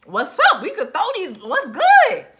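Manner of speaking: loud, high fighting call-out; Veronica's challenge. Retold or imitated. Recapping Veronica squaring up to fight, rowdy and loud. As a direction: build to a high, rowdy yell. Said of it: "And Veronica was like".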